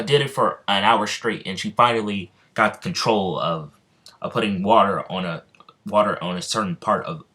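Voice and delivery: usual speaking voice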